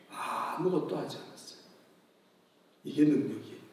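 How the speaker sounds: soft unvoiced whisper. Real, not acted. Preacher whispering how Jesus did nothing, hushed. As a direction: whisper it as a solemn secret.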